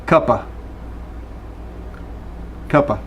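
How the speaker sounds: cuppa with of shrunk to a schwa; cuppa, cup of run together; reduced cuppa. Shown as a demonstration. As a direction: say cuppa as one quick word. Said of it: "you can think of this as".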